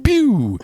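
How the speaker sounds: very high, loud "pew"; drawn-out falling zap noise; laser zap. Retold or imitated. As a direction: imitate shooting off as light. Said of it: "then he goes".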